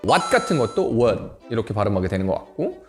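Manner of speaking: normal talking voice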